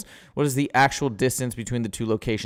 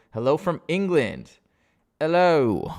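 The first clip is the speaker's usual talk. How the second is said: higher-pitched attempt at an English accent; 6 semitones higher, 4 dB louder, slower than usual. Tutor greeting a viewer from England in a hammed-up English accent. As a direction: say hello in a put-on English accent. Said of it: "I shouldn't do my English accent".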